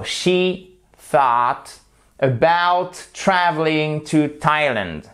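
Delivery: "she thought about traveling to Thailand" slow, with pauses between words; model pronunciation. Shown as a demonstration. Teacher repeating "she thought about traveling to Thailand", slow and deliberate.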